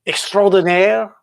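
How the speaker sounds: put-on French accent on the word; mock-French flourish. Shown as a demonstration. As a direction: say it as a Frenchman would, stretched out. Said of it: "in my French accent".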